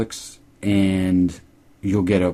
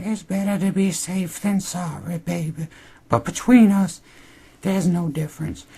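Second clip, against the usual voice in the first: put-on high, teasing old woman voice; 12 semitones higher than usual. Cashier teasing Noah about condoms, high and flirty. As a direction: lean in and purr it at him.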